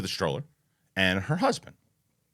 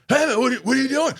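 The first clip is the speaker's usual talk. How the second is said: imitated indignant yell, pitched way up; angry confrontation; 13 semitones higher, 4 dB louder, faster than usual. Protective husband turning on the driver, imitated angry challenge. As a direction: call out a driver, high and agitated. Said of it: "turns back and goes".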